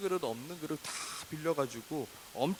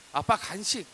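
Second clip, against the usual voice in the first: high, loud imitation of a child; demanding child; 6 semitones higher, 8 dB louder than usual. Child climbing into dad's car, demanding. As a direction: call out as a child making a demand.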